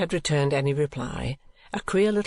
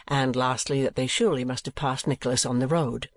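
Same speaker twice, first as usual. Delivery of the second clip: ordinary delivery, nothing marked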